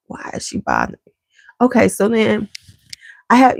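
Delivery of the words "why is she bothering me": cat's whispered thought. Retold or imitated.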